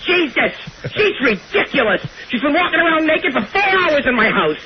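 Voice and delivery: high, flustered and complaining; exasperated high shriek. Acted. Back to himself, shooing Kathleen off the phone. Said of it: "Kathleen, give me your phone".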